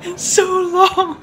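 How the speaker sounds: laughing through tears; half laughing, half crying, high. Real, not acted.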